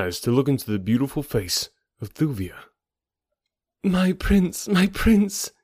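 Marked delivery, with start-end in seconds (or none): 3.8-5.5 high, joyful cry of a woman; ecstatic cry. A narrator's reading. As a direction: cry out in overjoyed relief. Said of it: "she cried in an ecstasy of happiness".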